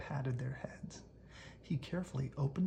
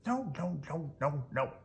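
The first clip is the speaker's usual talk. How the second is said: shouted; 2 semitones higher than usual. Acted. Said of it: "shouted the group".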